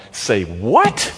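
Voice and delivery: baffled questioning of the verse; incredulous. Retold or imitated. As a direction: ask it as if you can't believe it. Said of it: "To which I respond".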